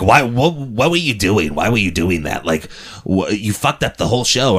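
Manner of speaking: imitated castmate demanding an explanation; Harvey Fierstein. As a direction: scold in a put-on character voice. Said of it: "he's just like".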